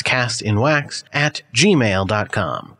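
spelled out slowly for listeners; careful slow repeat of the email; slow, clear repeat. Shown as a demonstration. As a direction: give the address again, deliberately. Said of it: "our email is castinwax at gmail dot com".

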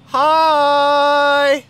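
loud, high, nervous greeting; overeager hi. Acted. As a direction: shout a bright forced hello. Waving to the feared villain.

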